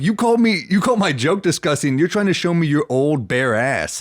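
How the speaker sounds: loud, high-pitched comic outrage; mock-incredulous rant at a heckler; retold indignant retort.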